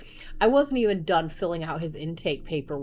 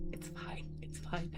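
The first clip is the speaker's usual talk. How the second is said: whispered reassurance; 15 dB quieter than usual. Retold or imitated. Mom whispering to calm her husband. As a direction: whisper it's fine twice. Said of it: "I'm just like".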